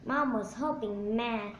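plain reading voice

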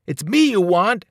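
loud, high challenge; yell. A narrator's reading. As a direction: call out to draw the attacker onto you. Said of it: "I yelled".

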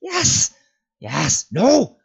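high, loud creature shriek; piercing, frantic creature cry. A narrator's reading. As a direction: burst out in panicked shrieks. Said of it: "shrieked Gollum".

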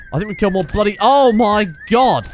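high and loud, dragging out the final cry; panicked outburst. Real, not acted. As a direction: lose it mid-sentence as chaos erupts. Panicked during fight.

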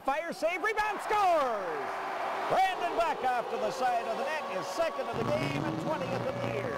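high, stretched scoring shout; long goal yell. Real, not acted. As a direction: draw out rebound, scores. Goal call.

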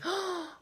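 sharp storybook intake of breath; sudden audible gasp; dramatic gasp. Acted. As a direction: gasp in mock alarm.

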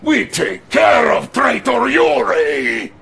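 loud, high vow of revenge; defiant shout. Acted. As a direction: bellow it at the enemy. Rallying shout about Yuri.

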